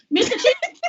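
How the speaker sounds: very high, laughing disbelief, retold; starstruck surprise. Retelling the celebrity's call from bed, starstruck surprise. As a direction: squeak the name as if jolted upright. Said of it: "I sat straight up in the bed like".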